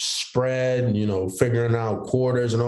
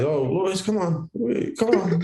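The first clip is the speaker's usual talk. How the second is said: higher, pleading mock coach call; coaches calling him; 10 semitones higher than usual. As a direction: imitate coaches begging him to join. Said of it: "I was one of those guys".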